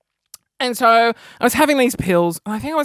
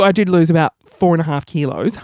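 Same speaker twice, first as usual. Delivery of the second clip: ordinary delivery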